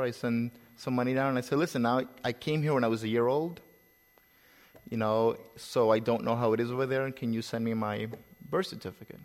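normal talking voice

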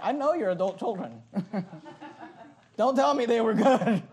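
laughing through a teasing jab; laughing retort. Retold or imitated. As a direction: tease them while laughing. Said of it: "I wanna look at people and say".

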